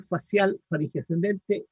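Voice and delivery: ordinary talk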